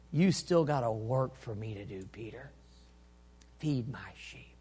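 low, soft, tender promise; gentle reassurance. Acted. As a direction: reassure him quietly and unhurriedly. Jesus reassures Peter.

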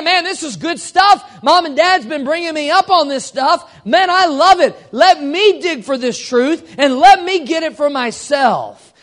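mock-excited kid voice. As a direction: act out the thrilled child eager to dig in. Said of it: "It's the idea".

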